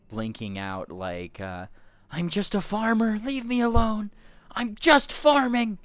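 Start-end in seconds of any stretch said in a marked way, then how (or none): 2.1-5.8 high loud whiny plea; pleading tree face. Acted. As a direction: beg to be left alone.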